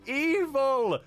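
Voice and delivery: high, drawn-out cry of outrage; high-pitched mock condemnation. Mock outrage at evil idea.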